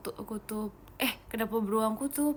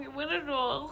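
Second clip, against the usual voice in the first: ordinary delivery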